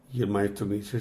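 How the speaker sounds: ordinary delivery, nothing marked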